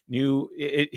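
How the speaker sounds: usual speaking voice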